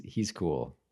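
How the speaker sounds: normal talking voice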